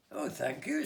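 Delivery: high, loud, sweet fairy voice; delighted fairy. Acted. The fairy thanks Olivia, high and loud. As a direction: chirp it high and pleased.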